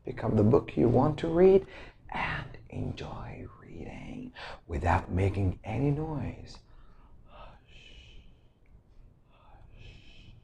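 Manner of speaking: whispered library shushing; very soft, low hushed instruction; hushing. Acted. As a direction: drop to a whisper so readers aren't disturbed. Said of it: "you don't have to raise your voice".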